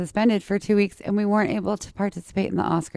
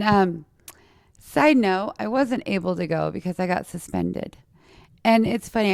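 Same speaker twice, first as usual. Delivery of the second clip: ordinary delivery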